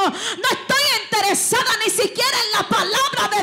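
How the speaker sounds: loud, high preacher's cry; impassioned revival sermon; fiery preaching. Real, not acted. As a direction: lift the church to its feet. Preaching at full force.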